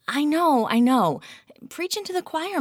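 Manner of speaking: a hint of a weary colleague; Lauren's voice. A narrator's reading. Lauren's voice, higher.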